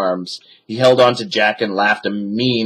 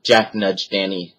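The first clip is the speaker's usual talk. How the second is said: plain reading voice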